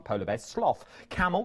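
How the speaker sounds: ordinary delivery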